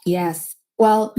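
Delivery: ordinary talk, nothing marked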